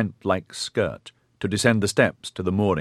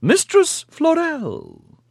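very high, slow, drawn-out indignation; indignant old matriarch; 4 dB louder, much slower than usual. A narrator's reading. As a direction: rise an octave in outrage. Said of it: "her wavery voice rising an octave with indignation".

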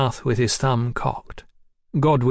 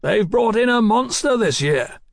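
raised voice, pitched high; shouted; 10 semitones higher than usual. A narrator's reading. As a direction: keep shouting over the din. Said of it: "Godwin shouted".

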